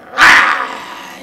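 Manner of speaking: low, loud growling "ah"; dog's growl. Acted. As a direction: snarl up at the cat as the dog. Dog growls at treed cat.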